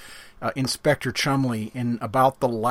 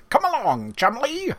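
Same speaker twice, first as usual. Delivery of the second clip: cartoon voice; 10 semitones higher than usual. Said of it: "It was, it was".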